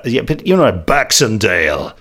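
ordinary talk, nothing marked

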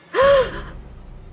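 high shocked gasp; sharp gasp. Acted. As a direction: gasp in horror. Narrator finds a dead body.

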